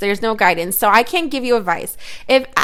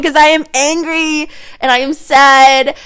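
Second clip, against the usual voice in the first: mock meltdown, building louder and higher; hammed-up emotional rant; 4 semitones higher, 5 dB louder than usual. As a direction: pile up the feelings, stretching out each one. Hammed-up rant listing clashing moods.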